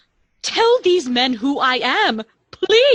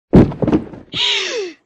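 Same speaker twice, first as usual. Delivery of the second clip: sudden high frightened gasp of shock; a startled intake of breath, high and loud; sharp gasp; 4 dB louder than usual. Acted. Young woman gasps in fright, alone in a dark room.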